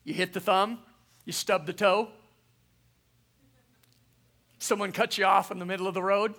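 ordinary delivery

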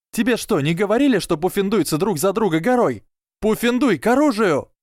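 high, impassioned yell, fast at first; rally your friends with a high battle cry; rallying shout. A narrator's reading. Ernie calls Hufflepuff to arms, rallying shout. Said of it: "закричал Эрни".

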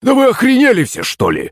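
high-pitched indignant outburst, dropping at the end; outraged frog cry. A narrator's reading. Servant turned frog to his friends, outraged cry. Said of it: "закричала лягушка голосом слуги".

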